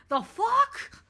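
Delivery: second, bigger take of the same shocked question; rattled disbelief. Pretend. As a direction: escalate the shock on the repeat. Repeats reaction, more rattled.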